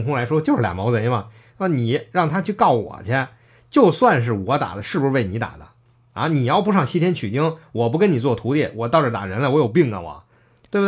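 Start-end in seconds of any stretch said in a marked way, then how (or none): none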